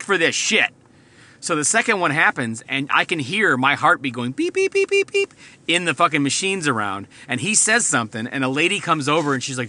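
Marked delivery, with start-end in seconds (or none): 4.5-5.1 monitor beeps. Retold or imitated. Imitating heart monitor beeps.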